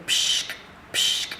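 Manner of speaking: imitated pneumatic sock hiss; pump hiss. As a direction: mimic the squeeze of the socks. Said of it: "pump-action socks that squeeze".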